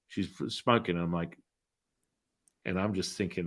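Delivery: ordinary talk, nothing marked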